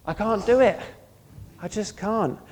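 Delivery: emphatic, loud confession of helplessness; exasperated cry of defeat. Acted. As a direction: throw up your hands and cry out that you can't. Preacher voicing a defeated believer.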